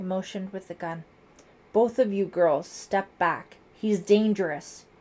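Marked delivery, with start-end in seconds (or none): 1.7-4.9 loud, urgent warning; angry raised voice. A narrator's reading. Lieutenant warns girls off suspect, angry raised voice. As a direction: order them away with force. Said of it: "raising his voice angrily".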